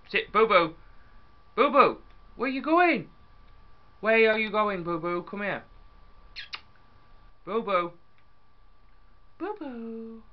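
high, loud doggy-calling voice. Real, not acted. Gamer calling his wandering dog, high pet-calling voice. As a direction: coo after a pet, high and sing-song.

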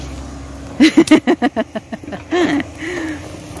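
long high giggling laugh. Real, not acted. Watching Mila with her machete, a long high laugh.

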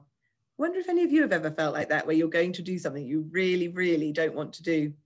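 usual speaking voice

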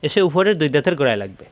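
ordinary talk

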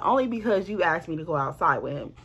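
ordinary delivery

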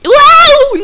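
loud high startled yelp; startled scream. Real, not acted. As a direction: jump at the scare and shout. Startled on the stairs.

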